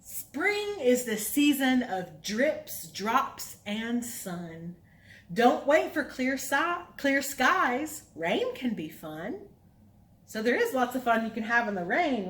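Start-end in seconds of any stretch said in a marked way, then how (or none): 0.0-9.4 put-on reading to children. Reads counting rhyme.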